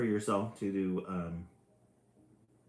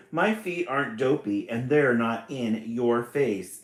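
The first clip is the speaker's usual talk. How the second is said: shouted; 3 semitones higher, 8 dB louder than usual. Acted. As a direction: shout it back at him, offended. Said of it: "she shouted".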